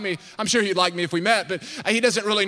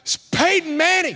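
starstruck exclaim; 8 semitones higher than usual. Retold or imitated. Telling friends, starstruck.

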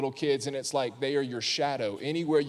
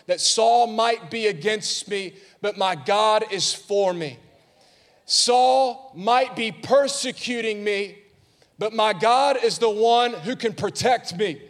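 slow, loud, high, every word hammered; emphatic preaching; 7 semitones higher, 4 dB louder, slower than usual. Acted. Preacher declaring God protects, slow loud emphasis. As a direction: drive the contrast home slowly.

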